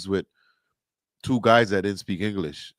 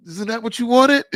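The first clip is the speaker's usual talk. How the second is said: high, loud mock-innocent question, playing dumb; 12 semitones higher, 6 dB louder than usual. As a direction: toss it back all innocent, pitched up high. Retelling his reply to Maria.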